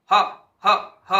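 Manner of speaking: ह pushed out as a loud breathy burst of air; exaggerated aspirated ह ह for students; breathy h demo. Shown as a demonstration. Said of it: "आप इनका उच्चारण करके भी देख सकते हैं".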